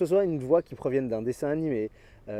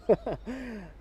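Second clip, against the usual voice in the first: short laugh; 7 semitones higher, 4 dB quieter than usual. Real, not acted. Laughs at own skit.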